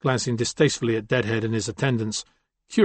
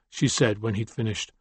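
ordinary delivery, nothing marked

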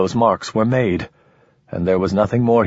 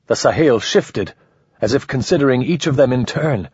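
plain reading voice

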